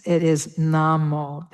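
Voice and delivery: "Namo" said NAH-moh, open A and long O. Shown as a demonstration. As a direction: model the right vowels clearly. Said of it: "that long O sound".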